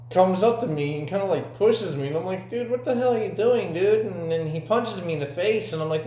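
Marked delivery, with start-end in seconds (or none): 2.5-4.1 high-pitched retold outrage; worked-up imitation of indignation; indignant protest. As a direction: act out protesting a shove, pitch raised. Acted protest at being pushed.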